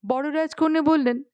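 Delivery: ordinary delivery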